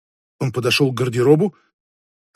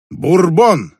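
loud, higher-pitched self-rebuke; exclaimed to oneself; 5 semitones higher, 7 dB louder than usual. A narrator's reading. Hero rebukes himself aloud, a loud exclamation. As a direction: throw the word out loudly at yourself. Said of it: "сказал он сам себе".

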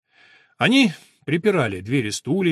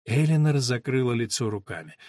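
plain reading voice

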